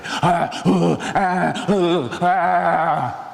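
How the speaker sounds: a hammed-up attempt at a scare; playful pretend monster sounds; trying to be scary. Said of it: "tried very hard to be scary".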